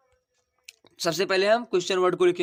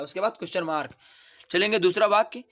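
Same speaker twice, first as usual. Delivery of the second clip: ordinary delivery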